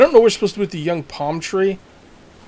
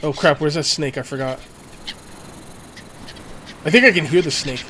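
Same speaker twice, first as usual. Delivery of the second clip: ordinary delivery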